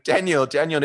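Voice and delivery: mock holler to someone far away; retold yelling. Fan shouting up at a distant balcony, yelling. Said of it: "I'm yelling out at him".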